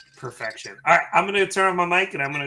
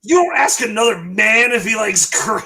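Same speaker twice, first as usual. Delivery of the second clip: retold angry customer; 4 semitones higher, 6 dB louder than usual. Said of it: "he started cursing at me".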